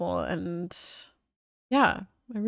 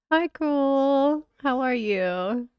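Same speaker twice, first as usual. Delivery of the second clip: higher, louder pet greeting; cooing at the dog; 7 semitones higher, 5 dB louder, slower than usual. Real, not acted. Owner cooing a greeting to her dog, higher and louder.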